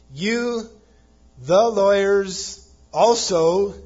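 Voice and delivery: "You, the lawyers, also" high and loud, each part set apart; very emphatic. Retold or imitated. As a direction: hammer out "You", "the lawyers", "also" separately, drawn out. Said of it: "is very emphatic".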